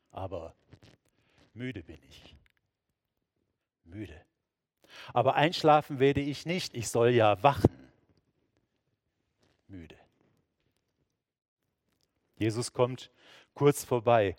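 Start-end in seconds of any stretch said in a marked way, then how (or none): 0.1-4.2 much softer, drifting into sleep; sleepy murmur. Acted. Peter fighting sleep, softly sinking. 5.1-7.9 startled awake. Acted. Peter rousing himself, suddenly louder. 9.7-10.0 barely there, drifting into sleep; sleepy sigh. Acted. Peter nodding off again, very softly.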